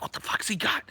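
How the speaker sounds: high, curious unvoiced whisper. A kid eyes what Joe holds, puzzled whisper.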